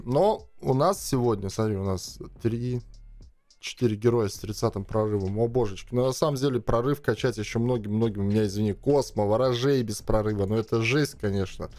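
ordinary talk

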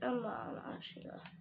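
soft unvoiced words; whispered. Real, not acted. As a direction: breathe it to yourself, lost. Lost in game.

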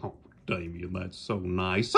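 normal talking voice

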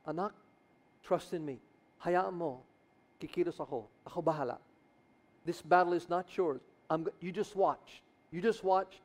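put-on calm a frightened child; tender reassurance. God speaking tenderly to believer.

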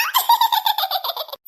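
high giggle that falls away; delighted giggle. Acted. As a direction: burst out giggling at a funny nickname. Child laughs at the nickname.

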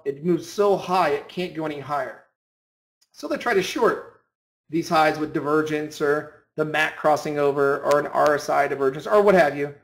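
ordinary talk, nothing marked

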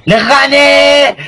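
slow, drawn-out victory yell; triumphant shout. Real, not acted. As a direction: stretch out the win, shouting. Gloating after winning match.

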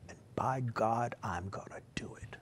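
whispered solemn oath; hushed vow. Shown as a demonstration. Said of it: "do you understand what I just said".

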